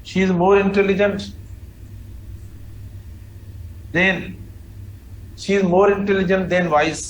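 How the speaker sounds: each word laid out very slowly for copying; slow dictation. Shown as a demonstration. Teacher dictating the example. (धीमे-धीमे लिखवाना)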